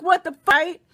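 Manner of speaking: ordinary delivery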